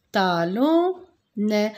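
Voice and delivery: talonnet again very slow and clear; slow model word. Shown as a demonstration. Teacher repeating talonnet slowly for the pupils. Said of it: "Regardez bien".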